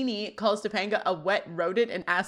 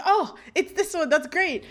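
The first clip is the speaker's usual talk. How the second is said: higher-pitched happy exclamation; delighted surprise; 9 semitones higher, 3 dB louder than usual. Retold or imitated. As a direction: re-enact a pleased little burst of recognition. Acting out delighted surprise at episode.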